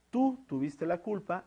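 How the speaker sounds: tú stressed and set apart from tuviste la culpa; emphasis on tú. Shown as a demonstration. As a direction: hit tú hard, as if singling the person out. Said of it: "le estamos dando un énfasis a".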